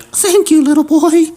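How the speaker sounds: very high falsetto granny; hammed-up old lady. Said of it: "she's like".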